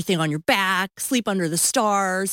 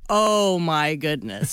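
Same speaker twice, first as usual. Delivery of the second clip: slow, lower weary exclamation; husband's exasperation; 4 semitones lower, slower than usual. Retold or imitated. Husband reacting to crying wife, slow exasperation. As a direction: draw it out in mock disbelief. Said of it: "he was just like".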